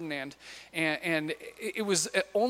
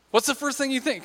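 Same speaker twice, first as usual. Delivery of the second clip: asked again higher, pressing for an answer; coaxing repeat; 7 semitones higher, 3 dB louder, faster than usual. Shown as a demonstration. Preacher egging on the crowd, coaxing. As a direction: prod the congregation to answer. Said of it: "Come on".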